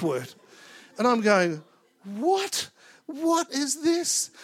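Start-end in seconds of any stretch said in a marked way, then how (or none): 2.0-4.3 high, slow, drawn-out "What? What is this?"; a preacher reliving his shock, much higher and slower; stunned disbelief. Retold or imitated. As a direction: let the disbelief stretch every word. Stunned by God's strange instruction.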